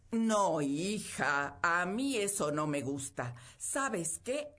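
loud, stern scolding; stern mother. Acted. Mother forbidding her daughter the rich suitor, loud stern scolding. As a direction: lay down the law to her daughter.

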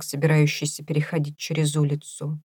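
ordinary delivery

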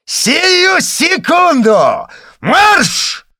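huge, high, slow roar. Acted. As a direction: roar it till the veins swell. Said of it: "заревел так, что на лбу вздулись жилы".

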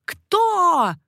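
high, loud, stretched cry; shouted question. A narrator's reading. Queen mother cries out to the sentries, loud shout. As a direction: shout it sharply upward in pitch. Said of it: "закричала королева-мать".

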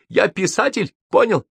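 high-pitched angry retort; barking. A narrator's reading. Гоголь puts Тургенев in his place, barking. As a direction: bark it at your rival. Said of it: "рявкнул Гоголь".

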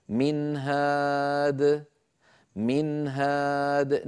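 very slow "من هاد", long level vowel in "هاد"; clear izhar recitation. Shown as a demonstration. Teacher shows silent noon made clear, slow izhar recitation. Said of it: "نون ساكنة مظهرة".

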